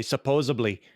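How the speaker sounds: lightly put-on Midwestern "Supposedly", with a B; mock Midwestern "supposably"; Midwestern accent. Shown as a demonstration. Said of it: "that's the true Midwestern way".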